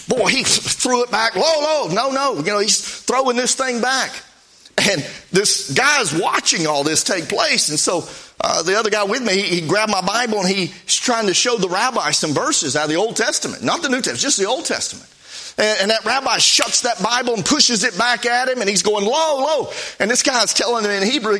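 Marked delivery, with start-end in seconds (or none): 1.4-2.4 high, pushy refusing cry; rabbi's refusal. Retold or imitated. Rabbi shoving the tract back at the preacher, high and insistent. 19.0-19.7 high, insistent refusal; rabbi's refusal. Retold or imitated. Rabbi pushing the Bible back at the witness, high and insistent.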